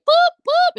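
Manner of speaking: squeaky high boop noises. Retold or imitated. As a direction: pop out two tiny high boops. Imitating quick reach-ins.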